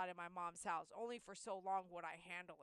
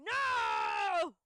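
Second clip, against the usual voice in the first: high, loud desperate screaming of no; frantic, crying yell, much higher and louder; panicked shout; 7 semitones higher, 10 dB louder, much slower than usual. Retold or imitated. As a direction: shriek at the dog not to die. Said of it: "looking at her like".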